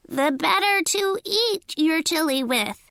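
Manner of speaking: high fake granny voice; high-pitched granny imitation. A narrator's reading. Coyote eager for Little Red's chili, fake granny voice. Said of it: "in a high-pitched voice".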